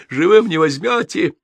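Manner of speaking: high-pitched cry of defiance; defiant yell. A narrator's reading. Cornered soldier yelling at his pursuers, high and defiant. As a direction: yell it as a last stand. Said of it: "проорал".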